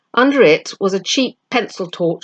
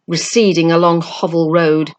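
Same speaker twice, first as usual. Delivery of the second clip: plain reading voice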